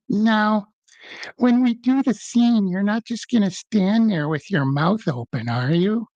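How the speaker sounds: a mild hint of the Capote drawl, slow at the start; Truman Capote voice. Actor on set warns young castmate, hint of Capote voice. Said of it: "in his Truman voice".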